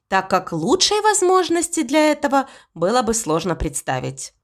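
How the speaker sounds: ordinary delivery, nothing marked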